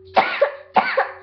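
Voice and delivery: single sick cough. Real, not acted. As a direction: cough once, hoarsely. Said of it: "Sorry, I'm kinda sick".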